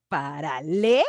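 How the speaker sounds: paralelos broken up, with LE held long and pushed; over-marked stress. Shown as a demonstration. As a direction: exaggerate paralelos as para-LEEE-los. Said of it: "de manera coloquial, pues no andas así de".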